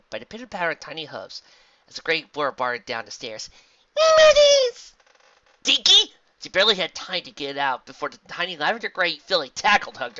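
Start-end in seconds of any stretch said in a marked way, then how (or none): 4.0-4.9 very high, loud squeal; excited little filly. Acted. 5.6-6.1 high, loud exclamation; surprised cry. Acted. Surprised pony calls filly.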